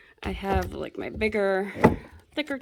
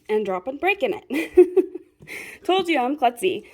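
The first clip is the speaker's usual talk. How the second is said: laughing; 4 semitones higher, 6 dB louder than usual. Real, not acted. Laughing at own clumsiness.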